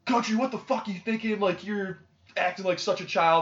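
high-pitched mock telling-off; retold scolding yell. Maddie telling him off at the bar, mock scolding yell. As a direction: imitate someone exasperated, pitch starting very high and easing. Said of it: "why are you yelling at me?".

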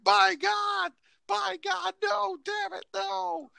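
high, anguished wrestling commentator call; Jim Ross. Retold or imitated. Announcer calls a toilet splash disaster, mock anguish. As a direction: cry out like a horrified ringside announcer. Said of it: "that's one Jim Ross should call".

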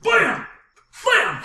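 high, loud shouted gunshot noise; shouted gun blam. Pretend. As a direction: yell the gunshot sound. Sound effect of gunshots.

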